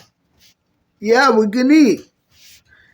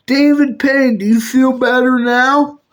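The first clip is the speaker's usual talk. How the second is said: usual speaking voice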